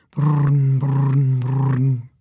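steady rolling purr; cat purring. A narrator's reading. Thelma settling in Hank's lap, contented purr.

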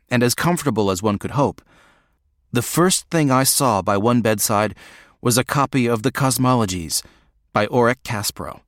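ordinary delivery, nothing marked

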